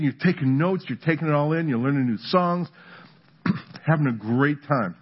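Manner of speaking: normal talking voice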